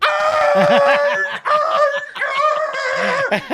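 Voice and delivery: high, drawn-out mock yelping; imitated dog howl. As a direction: wail like a dog skidding to a stop. Imitates dog's reaction on being called.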